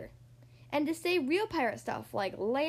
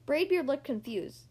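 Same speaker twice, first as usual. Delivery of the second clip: ordinary delivery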